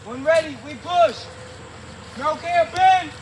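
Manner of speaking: loud high calls across the field; shouted countdown. Real, not acted. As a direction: yell the countdown to the team. Calling out countdown to team.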